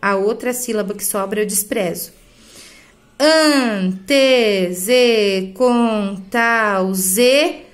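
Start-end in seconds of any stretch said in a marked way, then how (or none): 3.2-7.6 slow, separated syllables; drawn-out beats with linked vowels merged; syllable-by-syllable scansion. Shown as a demonstration. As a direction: sound out each poetic syllable. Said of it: "Aqui eu junto".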